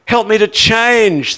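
high, loud and slow, begging the Lord for help; desperate plea to the Lord, loud and high; pleading prayer. Retold or imitated. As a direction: cry out to God for help, drawn out. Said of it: "where I need to say".

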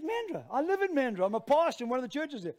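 high, loud burst of recognition; animated retold astonishment, speeding up at the end; excited surprise. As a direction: blurt it out, delighted at the coincidence. Retold surprise at shared home town.

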